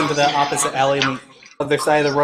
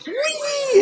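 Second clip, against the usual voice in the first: very high gnome whee; gleeful squeal. Acted. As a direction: squeal with childish glee.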